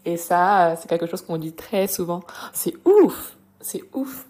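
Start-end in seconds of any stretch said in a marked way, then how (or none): 2.5-3.4 high, loud exclamation on "ouf"; amazed reaction. Shown as a demonstration. Teacher acting a reaction to someone's story, loudly amazed. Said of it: "pour réagir à ce que quelqu'un". 3.6-4.2 high, whispered disbelief; hushed amazed reaction. Shown as a demonstration. Teacher acting a reaction to someone's story, whispered amazement. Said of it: "pour réagir à ce que quelqu'un".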